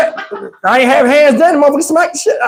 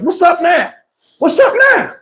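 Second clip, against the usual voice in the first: higher, rising taunt; mock challenge; 6 semitones higher than usual. Mo pointing at the crowd, climbing mock challenge.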